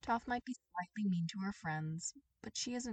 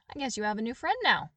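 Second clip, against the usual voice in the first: brash offer; 4 semitones higher, 10 dB louder, faster than usual. Acted. Toph offers friendship, brisk.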